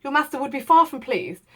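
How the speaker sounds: lightly voiced peevish scolding; a touch of fussy irritation. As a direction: lawyer mildly scolding the gathered servants. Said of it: "said the lawyer peevishly".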